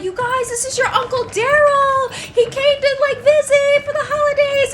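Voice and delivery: high-pitched, gushing mom voice; loud, high and thrilled; excited introduction. Retold or imitated. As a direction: imitate an overjoyed mom presenting a relative. Mom introduces uncle, acted.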